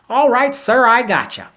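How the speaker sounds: high, loud eager lab worker; hammed-up high worker voice saying yes sir; compliant worker. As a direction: answer like an eager yes-man. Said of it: "Hey, bruh".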